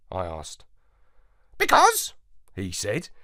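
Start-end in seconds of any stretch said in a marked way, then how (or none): 1.6-2.1 high, louder parrot voice, sneering; scornful parrot. A narrator's reading. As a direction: warn him like a scornful old parrot. Parrot answers.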